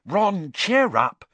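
high, loud, drawn-out urging; rally a glum friend; cheering encouragement. A narrator's reading. Girl pushing stew towards her friend, cheering him up. Hermione encouraging worried Ron.

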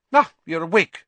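brisk. A narrator's reading. Nurse to waking patient, brisk. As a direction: greet the patient briskly, voice lifted. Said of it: "she said briskly".

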